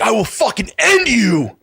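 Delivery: put-on a rock star losing it at a kid; mock threat. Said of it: "And he's like".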